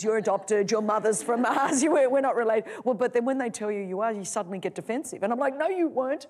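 usual speaking voice

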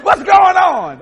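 hammed-up hearty hello; casual chat with God. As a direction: greet the Lord like a buddy. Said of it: "I just talk to the Lord like that".

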